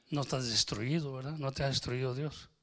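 ordinary talk

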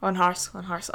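a quick, gruff Kildare-accent joke. Shown as a demonstration. Showing off a Kildare accent to friends. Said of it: "there's my Kildare accent".

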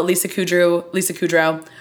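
normal talking voice

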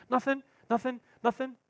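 put-on high, quick denials like a caught boy; scared kid. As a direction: play the kid frantically denying. Kid denying again.

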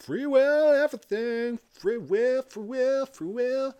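high, loud chanting of free will; mock free-will obsessive. Mocking free-will believers in a conversation, loud and high. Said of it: "They're just like".

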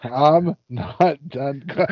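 slow word-by-word mock gloat; drawn-out imitation, broken off; imitated gloating line. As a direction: echo a wrestler stretching every word. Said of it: "and he goes".

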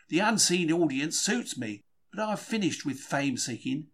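usual speaking voice

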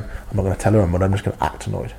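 low, hushed aside to himself; withheld annoyance. Acted. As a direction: keep it under your breath, as a private resolve. Acts out silently sulking at wife.

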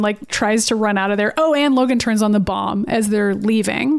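normal talking voice

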